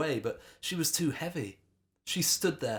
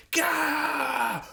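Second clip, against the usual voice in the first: high loud battle cry; battle roar; 6 dB louder than usual. A narrator's reading. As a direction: roar like charging in. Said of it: "roared Wade".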